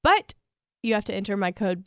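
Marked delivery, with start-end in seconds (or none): 0.0-0.3 high and loud; sudden loud emphasis. Real, not acted. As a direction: punch the but. Ad-read emphasis.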